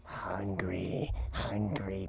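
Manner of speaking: hissing whispered swarm voice; buzzing insect swarm chorus. Acted. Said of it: "Do your best swarm voice".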